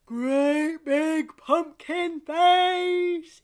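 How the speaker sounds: high, slow, loud stretched-out call; big bright pumpkin face, drawn out. Shown as a demonstration. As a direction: open everything wide and stretch the words. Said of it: "we have to do".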